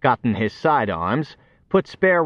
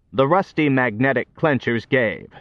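usual speaking voice

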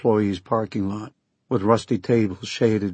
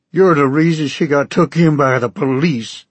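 loud, higher Okie farmer yelling; angry shout; 4 semitones higher, 5 dB louder than usual. Acted. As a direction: bellow it at the man who wronged his daughter. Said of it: "he shouted".

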